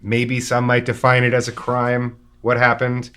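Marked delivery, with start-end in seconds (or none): none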